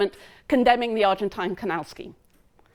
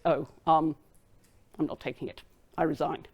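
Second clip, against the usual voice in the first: subdued resignation; 6 semitones lower, 6 dB quieter than usual. Retold or imitated. Sir Robert gives up cabinet seat.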